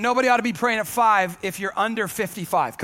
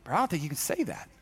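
mock aside; 6 semitones lower, 6 dB quieter, faster than usual. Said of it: "And I'm going".

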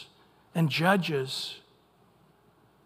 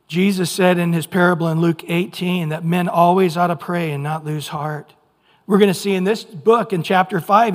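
usual speaking voice

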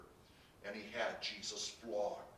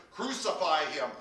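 loud, raised mob shout; shouting louder; 4 semitones higher, 8 dB louder than usual. A narrator's reading. Crowd overrides Pilate's third appeal, shouting. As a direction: shout it out insistently. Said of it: "they shouted all the louder".

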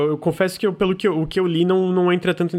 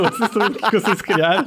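laughing through the repeat; 5 semitones higher than usual. Real, not acted. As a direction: giggle out the question.

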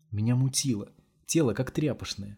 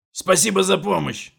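глумливо; 9 semitones higher, 8 dB louder than usual. A narrator's reading. Довольный Томаш насмехается над убитым.

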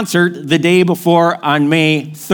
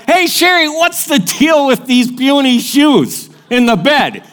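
calling out surprised; 5 semitones higher than usual. Retold or imitated. Calling out to wife.

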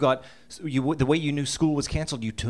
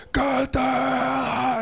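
screaming dad; 11 semitones higher than usual. Retold or imitated. Father yelling after the fleeing boys, angry shout. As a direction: bark the order like a furious dad. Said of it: "He was screaming".

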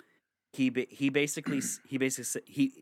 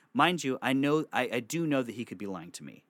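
normal talking voice

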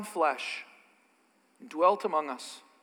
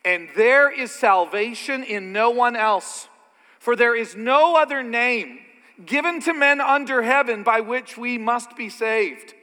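bold proclamation; 6 semitones higher, 7 dB louder than usual. Acted. Preacher booming Peter's words to the church, loud and high. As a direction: proclaim it boldly to the room.

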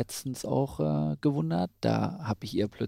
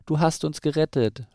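higher-pitched grateful cheer; praising; 6 semitones higher, 5 dB louder than usual. A narrator's reading. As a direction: brothers hailing their rescuer, lightly acted. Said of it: "lobten sie ihre Brüder".

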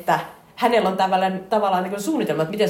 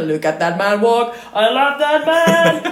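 high, loud, slow overacted declaration; slow, loud, high mock bellow; hammed-up possessive shout; 7 semitones higher, 5 dB louder, much slower than usual. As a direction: proclaim it like a jealous comic lover. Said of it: "se sanoo".

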